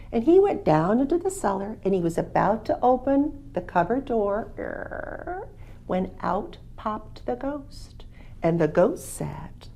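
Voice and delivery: ordinary delivery, nothing marked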